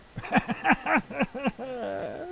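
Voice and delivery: high, rapid cackling laugh; loud, high fit of laughter; real belly laugh. Real, not acted. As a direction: burst out laughing at your own joke. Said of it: "don't know why I'm laughing".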